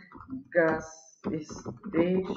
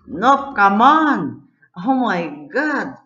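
loud, slow, drawn-out protest; frustrated shout; 4 semitones higher, 9 dB louder, slower than usual. Real, not acted. The car fails again, frustrated loud shout. Car glitches mid race.

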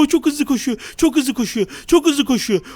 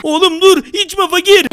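louder, higher warning call; urgent shout; 4 semitones higher, 5 dB louder, slower than usual. Acted. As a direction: yell to stop him drinking. Stopping friend from drinking. (acil bağırış)

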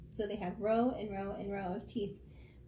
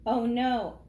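louder alarmed cry; 7 dB louder than usual. Acted. Kids hearing dentists are mean, a loud alarmed oh no. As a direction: gasp it out in dismay. Friends react to scary dentist claim.

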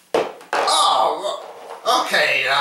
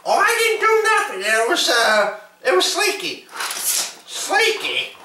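high toy voice; 11 semitones higher, faster than usual. Pretend. Toy characters argue and blame.

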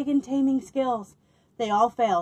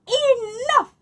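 exasperated cry; 13 semitones higher, 6 dB louder than usual. Acted. Fed-up villagers cry out.

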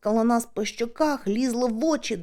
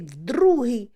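plain reading voice

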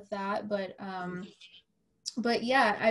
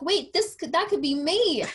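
much higher and louder, rising alarm; retelling her mid-race panic about collapsing, higher and louder; retold panic; 8 semitones higher, 6 dB louder than usual. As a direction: let the panic take over as it dawns on you. Panic at mile seventeen.

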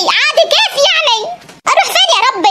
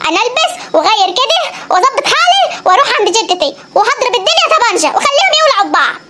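ordinary delivery

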